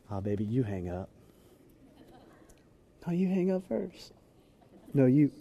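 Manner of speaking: hushed, cooing lovebird; lovesick phone sweetheart. Acted. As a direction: coo softly, not wanting to hang up. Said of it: "you've been there where you've been like".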